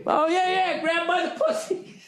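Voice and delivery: high, giggly fawning laugh through the words; giggling along. Retold or imitated. Billy Bush giggling along with Trump's boast, high and laughing. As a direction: play the sidekick laughing along eagerly.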